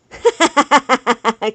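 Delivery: loud, rapid burst of laughter. Real, not acted. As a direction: laugh out loud in a long cackling run. Laughs at warranty joke.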